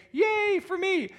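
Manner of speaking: high, drawn-out self-mocking cheer; sing-out a silly little hooray for yourself; mock celebration. Reading his old plaque aloud, mock celebration.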